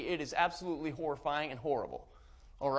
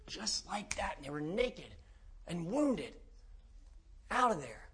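hushed whisper; 7 dB quieter than usual. Acted. Preacher hushing the congregation with the demon story, whispered. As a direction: drop to a whisper.

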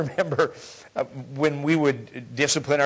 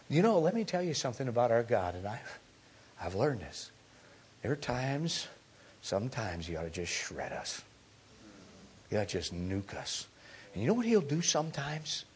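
ordinary talk

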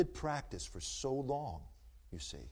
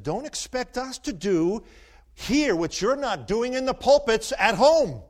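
loud, slower, higher declaration; emphatic quote; 10 semitones higher, 14 dB louder, slower than usual. Retold or imitated. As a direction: hammer the chairman's challenge home. Quoting RNC chairman's challenge.

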